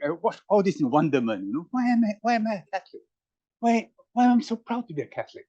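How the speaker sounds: ordinary talk, nothing marked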